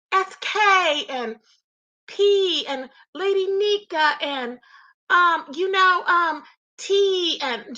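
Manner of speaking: slow, over-careful mimicry of names spelled out as initials; mocking an over-precise talker; mock slow enunciating. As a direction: drag each initial out as if enunciating on purpose. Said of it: "all this enunciating and slow talking".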